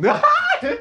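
squealing, very high laugh; high-pitched laugh. Retold or imitated. Reage à cantada do rapaz. (risada aguda, em gritinho)

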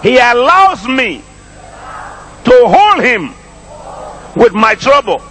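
high preaching voice, broken into short phrases; slow call-and-repeat with long pauses between phrases. Shown as a demonstration. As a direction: proclaim it piece by piece for the congregation to echo. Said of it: "make that a little slower".

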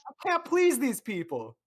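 exasperated. Real, not acted. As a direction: groan it out high and loud. Exasperated at chat.

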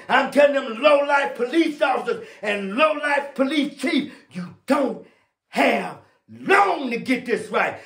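urgent pulpit warning in a high, driving cadence; fiery preaching. Real, not acted. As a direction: preach judgment hard, stretching the big words. Preaches a warning at full force.

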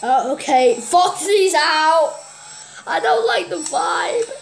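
ordinary talk

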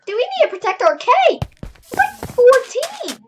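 high, loud and incredulous; alarmed disbelief. Real, not acted. Player realizes four teams threaten cake, alarmed disbelief. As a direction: raise the pitch sharply in alarm.

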